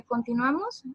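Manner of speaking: ordinary talk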